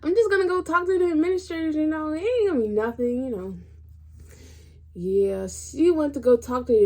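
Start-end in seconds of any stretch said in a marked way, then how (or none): 0.0-3.6 high, loud, quick mock mom voice; breezy mom. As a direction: play the mom brushing it off cheerfully. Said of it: "thinking she was gonna be like".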